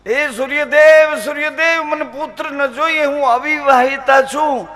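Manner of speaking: high, loud pleading cry. Acted. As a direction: call out to the sun god in panic. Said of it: "Kunti તો ચીસું પાડે, બૂમ પાડે". (ઊંચા, જોરથી ચીસ પાડતી વિનંતી)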